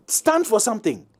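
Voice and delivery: usual speaking voice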